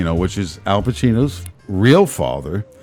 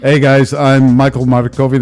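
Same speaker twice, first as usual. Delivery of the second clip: polished announcer intro; mock-formal TV host; proper host voice; 3 semitones higher, 5 dB louder than usual. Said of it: "the Brooklyn accent has gotta go out".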